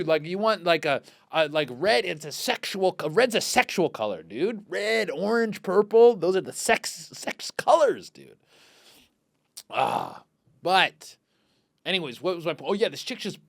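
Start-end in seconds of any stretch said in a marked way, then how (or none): none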